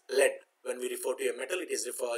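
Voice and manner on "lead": "lead" said with a short e, like "led"; pronounced LED. Shown as a demonstration. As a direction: rhyme "lead" with bed. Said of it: "it is pronounced as".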